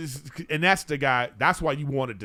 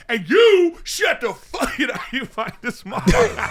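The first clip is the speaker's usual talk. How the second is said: mock angry shout; 10 semitones higher, 8 dB louder than usual. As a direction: yell it at the smallest guy, hammed up. Acts out picking on smallest guy.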